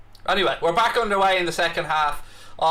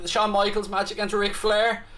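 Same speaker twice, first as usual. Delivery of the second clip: ordinary delivery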